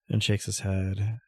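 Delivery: normal talking voice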